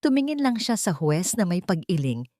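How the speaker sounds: ordinary delivery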